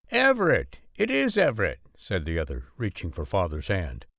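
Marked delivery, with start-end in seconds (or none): 0.1-1.8 joyful recognition. Acted. Farmer recognizes old classmate.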